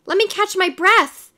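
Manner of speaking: flustered, high-pitched appeal; crying out. A narrator's reading. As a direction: beg the crowd for a pause. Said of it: "he cried".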